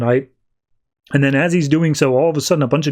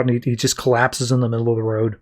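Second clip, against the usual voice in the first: ordinary delivery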